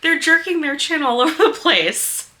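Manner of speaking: high-pitched, cracking up with real laughter; trying not to laugh. Real, not acted. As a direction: laugh helplessly through the words. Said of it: "I can't even say it with a straight face".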